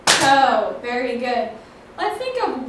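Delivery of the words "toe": Toe said loud as one firm beat; counting syllables. Shown as a demonstration. Teacher counts a one-syllable word aloud, loud.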